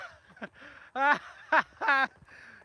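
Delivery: big loud laugh; loud burst of laughter. Real, not acted. Laughs at phone number request.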